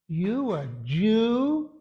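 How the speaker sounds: very slow Southern sergeant drawl. Retold or imitated. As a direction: stretch it out in an imitated Southern drawl. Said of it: "in his southern drawl goes".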